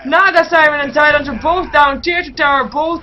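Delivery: high, loud esports hype; full-force excited play-by-play; shouted climax call. Real, not acted. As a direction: build to a shout as the kills land. Climax of team fight commentary.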